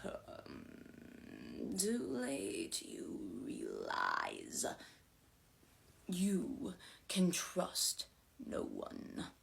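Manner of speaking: very slow, soft, whispery menace; eerie wraith, words drawn out; humorless, sinister spirit. A narrator's reading. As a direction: gloat in a hushed ghostly voice. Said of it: "laughed without humor".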